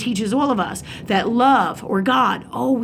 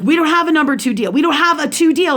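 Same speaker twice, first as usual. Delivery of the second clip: higher-pitched, insistent mock yell; raised-voice argumentative retelling; retold yelling; 6 semitones higher, 4 dB louder than usual. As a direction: imitate someone yelling a flat refusal. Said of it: "like, yelling at me".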